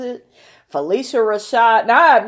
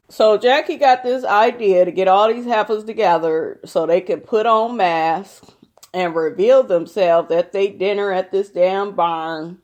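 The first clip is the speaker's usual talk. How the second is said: ordinary delivery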